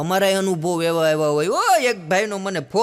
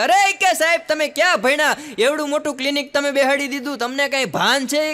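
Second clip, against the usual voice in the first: high, loud, furious telling-off of the doctor; angry scolding; 8 semitones higher, 4 dB louder than usual. Retold or imitated. A patient storming into the doctor's clinic, high and loud with rage. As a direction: chew the doctor out as an outraged patient.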